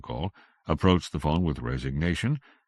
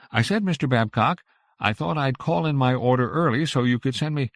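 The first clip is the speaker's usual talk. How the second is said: louder, higher into the phone; raised voice; 11 semitones higher, 7 dB louder than usual. Acted. Missus Allison raising her voice to the grocer on a poor line. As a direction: raise your voice over a bad line. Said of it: "raised her voice a little".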